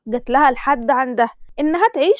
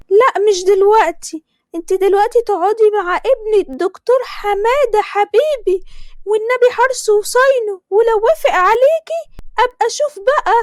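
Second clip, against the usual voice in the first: put-on higher, louder gushing older woman; groom's mother blessing; 9 semitones higher, 3 dB louder than usual. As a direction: lay the blessing on thick, pitched up. (حماة بتبارك بصوت عالي ومبالغ)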